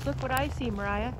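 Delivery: ordinary talk, nothing marked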